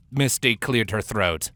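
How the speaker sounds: plain reading voice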